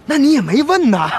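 high, loud reply through laughter; laughing retort. Acted. Captain answers the villager's reproach for not saying sooner, laughing. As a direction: tease back with a laugh.

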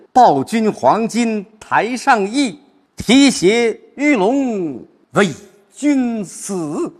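high, loud, drawn-out declamation; rousing recitation. Shown as a demonstration. Teacher turning to resolve despite hardship, rousing high recitation. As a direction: rise against the odds, stretching every phrase. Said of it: "尽管这么困难， 但是".

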